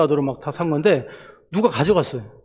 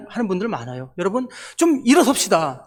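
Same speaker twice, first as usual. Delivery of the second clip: ordinary delivery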